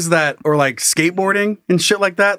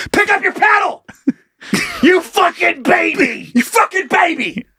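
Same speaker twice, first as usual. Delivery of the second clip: mock shouting; 12 semitones higher than usual. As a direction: bark it like an exasperated raft captain. Mock yelling at crying partner.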